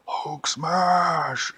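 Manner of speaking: loud, roaring monster cry; Hulk. Acted. Green monster threatens the cookie, roaring. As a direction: bellow it like a raging cartoon brute. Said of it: "he turned into the Hulk".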